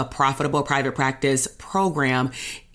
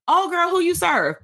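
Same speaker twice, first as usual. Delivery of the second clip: much higher, mocking sassy girlfriend tone; 12 semitones higher than usual. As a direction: toss off the question like a dismissive friend. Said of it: "compared to".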